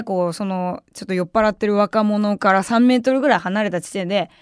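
ordinary talk, nothing marked

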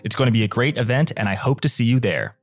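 upbeat sponsor read, lightly sold. Acted. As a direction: pitch the event like a podcast ad. Host reads sponsor ad.

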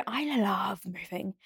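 breathy whisper, slow and soft. Real, not acted. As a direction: whisper it like a little secret. Secretive aside about loving moving.

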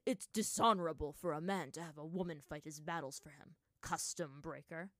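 ordinary delivery, nothing marked

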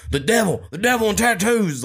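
high, overwrought ranting dad; reality show dad. Retold or imitated. Dad ranting about tattoos, hammed-up high outrage. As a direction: camp it up like a reality star. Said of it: "someone off a reality show".

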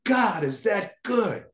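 high-pitched, drawn-out exclamation of a schoolgirl; imitated scream. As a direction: cry it out slowly, imitating an amazed classmate. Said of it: "and she screams".

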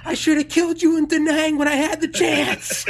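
high, loud, laughing threat; furious threat. Retold or imitated. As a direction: scream it as a mock-vicious threat. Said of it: "And he goes".

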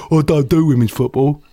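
lightly put-on voice of a dismissive fan; a hint of a mocking impression; mock naysayer. Pundit mimics people who dismiss women's football, lightly mocking voice. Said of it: "that's how they all talk".

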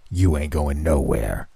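low, slow, threatening crone voice; menacing old hag. A narrator's reading. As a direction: growl it like a creepy old woman. Old hag pins narrator down.

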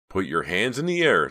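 forceful command. A narrator's reading. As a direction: order them like an armed guard. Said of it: "the guard instructed".

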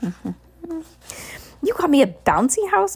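high, slow disbelief; amazed cry. Acted. Bruce discovering the bouncy house, crying out amazed. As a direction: draw it out in astonishment. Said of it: "Bruce cried".

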